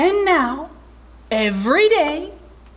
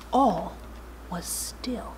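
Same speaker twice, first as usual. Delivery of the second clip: softer, lower bedtime hush; 4 semitones lower, 10 dB quieter, slower than usual. A narrator's reading. Narrator settles the sleeping zoo, hushed. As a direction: let it fade toward a whisper.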